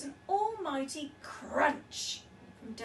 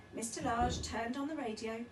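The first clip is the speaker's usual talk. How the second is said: usual speaking voice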